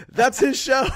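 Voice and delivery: high, loud, gleeful announcement; excited blurt. Retold or imitated. Golfer raising his hand to claim the show, high loud glee. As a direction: blurt it out like a delighted reveal.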